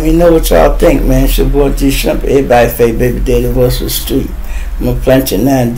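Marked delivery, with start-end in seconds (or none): none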